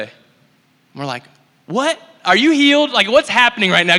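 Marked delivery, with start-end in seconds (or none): 1.7-4.0 much higher and louder, incredulous; can't believe it's working; shocked disbelief. Retold or imitated. Friends asking the man if he's healed, in shocked disbelief. Disbelief at second healing report.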